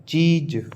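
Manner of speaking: चीज with a drawn-out "ee"; long ee vowel. Shown as a demonstration. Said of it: "क्योंकि बड़ी ई की मात्रा है".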